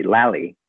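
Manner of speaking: Lally with L for R, as in "Larry"; mock accent Lally. Shown as a demonstration. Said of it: "change your R's to L's".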